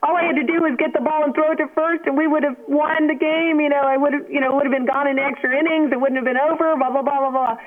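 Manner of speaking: put-on high, fast pleading child's voice; retold tearful pleading. Kid pleading with dad in the car, high and fast. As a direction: plead like a crying kid who blew the game. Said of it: "I said".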